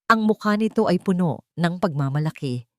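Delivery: usual speaking voice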